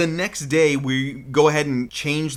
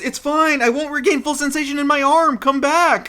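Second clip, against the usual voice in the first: high, louder hammed-up father calling after her; hammed-up father pleading, come back; mock dad calling out; 12 semitones higher, 4 dB louder than usual. Dad calling after fleeing daughter.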